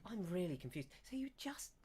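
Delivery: high, light voice of a confused villager; puzzled villager. Acted. Villager questioning visitors about giving metal away, confused.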